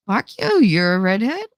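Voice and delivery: squeaky high sing-song; high mock greeting. Playful greeting to redhead.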